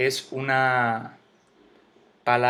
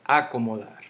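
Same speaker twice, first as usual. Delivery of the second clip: "acomodar" hit on the opening A; misplaced stress; 4 dB louder than usual. Shown as a demonstration. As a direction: punch the first syllable of "acomodar" as a wrong example. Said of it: "Yo no digo".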